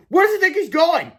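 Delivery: high, loud call on the run; exasperated question. A narrator's reading. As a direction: ask in alarm. Boys chase runaway hero.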